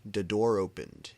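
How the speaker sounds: plain reading voice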